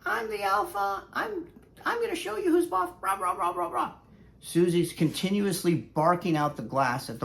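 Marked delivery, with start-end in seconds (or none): 0.0-2.9 high, fast bossy dog voice; high squeaky tough-dog voice; bossy little dog. Pretend. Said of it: "And Susie's like". 3.0-3.9 mock barking. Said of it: "And Susie's like".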